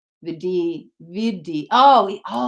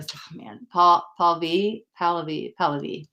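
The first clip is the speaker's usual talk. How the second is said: ordinary talk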